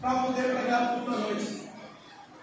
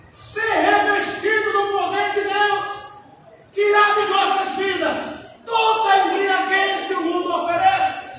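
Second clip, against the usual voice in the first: forceful, projected sermon voice; fervent preaching; 5 semitones higher, 6 dB louder than usual. Real, not acted. Preacher urges the church to seek God, fervent preaching. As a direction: preach with urgency, drawing out key phrases.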